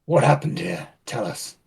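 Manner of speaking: very low, controlled questioning; interrogator. Acted. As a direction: press the droid in a deep, dropped voice. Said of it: "Walk up to it and say".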